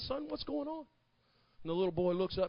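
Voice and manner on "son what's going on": gentle dad. Acted. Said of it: "Dad walks down to where the little boy is".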